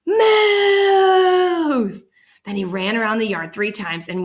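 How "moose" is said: high, loud shout of the animal's name; yelled Moose. A narrator's reading. Boy spots a moose and yells to everyone. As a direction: call it out at the top of your voice. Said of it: "Luke looked at it and yelled".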